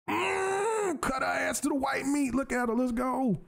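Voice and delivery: high shouted trash talk, half crying out; hype yell. Real, not acted. Gamer yelling at the boss he is beating, high excited shout. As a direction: yell it at the screen like a victory cry.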